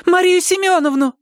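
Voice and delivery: thin high ringing call. Acted. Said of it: "звеняще тонко пропела".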